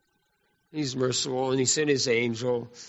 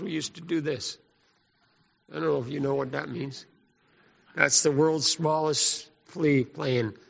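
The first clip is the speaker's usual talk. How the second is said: ordinary talk, nothing marked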